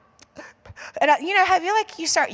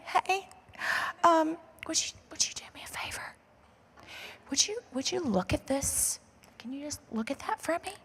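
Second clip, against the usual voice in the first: 1 secretive whisper; 3 semitones higher, 9 dB quieter, faster than usual. Retold or imitated. Secretly asking clerk about fake bag.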